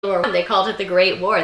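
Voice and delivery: normal talking voice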